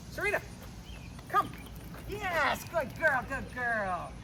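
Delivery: high happy call then praise; high cheerful praise for the dog. Real, not acted.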